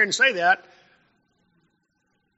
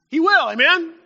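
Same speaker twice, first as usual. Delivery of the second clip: preacher's rallying call; 8 semitones higher, 5 dB louder than usual. Real, not acted. Preacher affirming to congregation.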